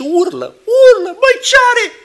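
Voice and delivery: high, loud exasperated cry; a flustered parent over a screaming baby; alarmed parent. Retold or imitated. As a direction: parent shouting over a howling newborn.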